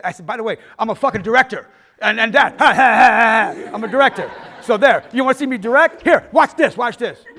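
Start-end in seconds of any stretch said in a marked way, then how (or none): 0.2-2.6 loud, raised-pitch retort; acted triumphant comeback at full voice; defiant outburst. Acting out his defiant comeback to dean. 2.6-3.7 loud, high gloating ha-ha; drawn-out forced laugh of defiance; acted crowing laughter; mock triumphant laugh. 3.7-7.2 loud, high challenge; acted taunting dare at full voice; defiant boasting. Acting out boastful challenge to dean.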